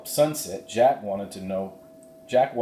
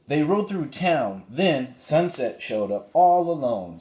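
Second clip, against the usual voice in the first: put-on higher eager girl's voice; voicing Annie; 7 semitones higher, 4 dB louder than usual. Annie tells the cowboy about the foal, higher girl's voice. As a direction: Annie explaining in a bright higher voice.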